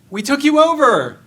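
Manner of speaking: mock indignant shout. Napoleon's army shouting at empty Moscow, mock indignant and loud. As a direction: yell it again like an ignored conqueror. Repeated boast, nobody answers.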